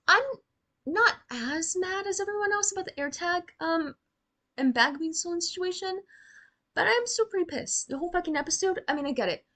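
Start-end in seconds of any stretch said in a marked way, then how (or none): none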